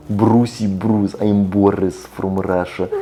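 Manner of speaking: slow, hammed-up Russian accent on Brucey Bruce; mock Russian accent. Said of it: "why do you have to make sure people know that you have the Russian accent".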